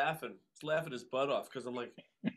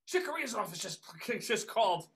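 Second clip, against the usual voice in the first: mother's excited voice; 6 semitones higher than usual. Retold or imitated. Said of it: "so she goes".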